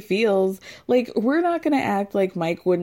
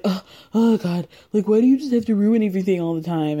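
fast, louder exasperated complaint; frustrated; 4 dB louder, faster than usual. Retold or imitated. Mike frustrated at Natalie, fast exasperated outburst. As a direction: imitate him snapping in irritation. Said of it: "Mike gets frustrated immediately".

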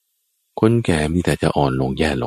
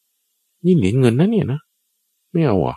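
slow, higher coaxing voice for the god; coaxing offer; 6 semitones higher, much slower than usual. Acted. Sakka coaxes the silent queen, slow and high. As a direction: tempt her gently with the coins.

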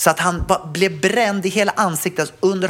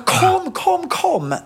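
retold shout for help; 14 semitones higher, much slower than usual. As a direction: yell for strangers to come and help. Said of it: "jag bara, så".

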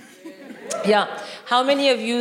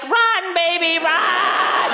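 yelling at the TV; cheering shout; 7 semitones higher, much slower than usual. Retold or imitated. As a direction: urge it on at the top of your voice. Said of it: "you were like".

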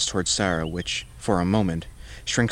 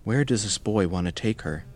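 ordinary delivery, nothing marked

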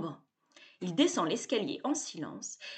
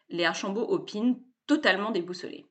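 ordinary delivery, nothing marked

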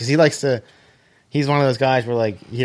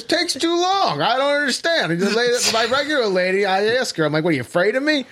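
much higher, put-on voice for the uncle's gripe; imitating uncle; 10 semitones higher than usual. Uncle grumbling about the server, high mock indignant voice. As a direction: squeak it like an indignant old man.

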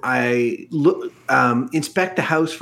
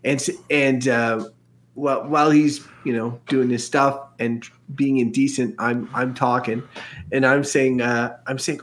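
normal talking voice

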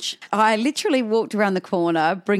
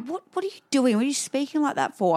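higher-pitched puzzled protest; baffled husband; 7 semitones higher, faster than usual. Retold or imitated. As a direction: question her odd tone, mildly put out. Baffled husband answers her.

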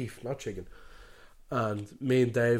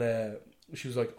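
ordinary talk

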